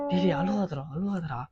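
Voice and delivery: soft, furtive hushing; hushed soothing. Acted. Father hiding his crying boy from mother, hushed soothing. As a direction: quietly beg the child to stop crying.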